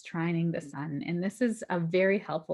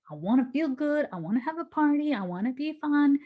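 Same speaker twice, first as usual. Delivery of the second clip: put-on childish wanting; 8 semitones higher than usual. Voicing Mars's greedy desire.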